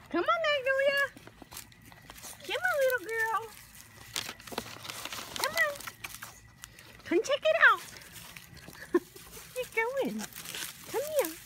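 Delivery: sing-song high call to the dogs. Real, not acted. Owner calling her dogs to come see, high and sing-song. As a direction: coax the dogs over in a high voice.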